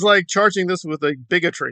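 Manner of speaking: normal talking voice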